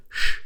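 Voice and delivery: sh said as a clear hushing sh; a demo of sh; the sh sound. Shown as a demonstration. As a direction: give the sh sound on its own, drawn out. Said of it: "what we would write S H".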